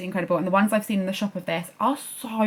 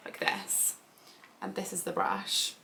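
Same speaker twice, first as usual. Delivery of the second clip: hushed whisper, much softer; 13 dB quieter than usual. Shown as a demonstration. Vlogger showing viewers the whispered way, hushed. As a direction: drop into a near-silent whisper, like ASMR. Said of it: "normal or like this".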